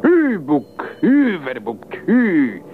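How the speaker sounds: high, drawn-out call; cried command to an animal. A narrator's reading. The devil to his animal that won't budge, crying out. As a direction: holler it high, as if urging a beast on. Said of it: "le diable distrait cria à l'adresse de l'animal".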